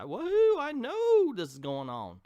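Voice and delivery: "Woohoo" squealed very high, then a bouncy line; hammed-up giddy whoop; mock cheer. Reviewer mocking an unsurprised hero with fake glee. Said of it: "Not a".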